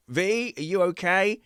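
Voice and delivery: high, slow call to daughter. Retold or imitated. Father calling to daughter in cubicle, high and slow. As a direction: gently call through the door.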